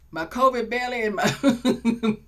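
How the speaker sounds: laughing through the words about her outfit; self-mocking laugh. Real, not acted. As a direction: giggle while you list it.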